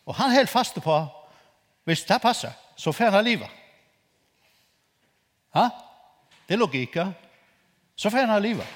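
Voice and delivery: high and loud, building to a peak; fervent sermon climax; fiery preaching. Acted. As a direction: preach it at full force. Preacher builds to full force.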